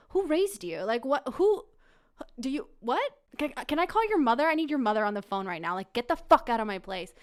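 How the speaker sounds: loud, sputtering acted outrage; indignant tirade. Woman demanding intruder's mother, loud indignant rant. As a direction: scold him in loud disbelief.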